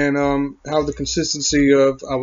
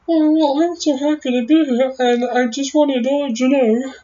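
much higher, tiny chirpy voice as if it's a small creature; squeaky little voice; 12 semitones higher than usual. Retold or imitated. A little throat part speaking, in a squeaky high voice. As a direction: pitch it way up and sound delighted to be here. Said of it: "part here that kind of talks like this".

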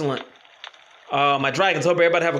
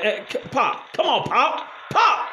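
calling out; 7 semitones higher, slower than usual. Real, not acted. Player calling his teammate Pop to come help, rising call. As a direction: call your teammate over, pitch climbing.

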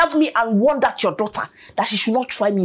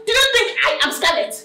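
usual speaking voice

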